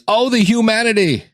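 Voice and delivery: high, anguished quoting of the reporter; quoting a broadcaster's anguished cry, pitched high; famous radio cry. Retold or imitated. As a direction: echo the Hindenburg announcer's wail. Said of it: "If I said the phrase".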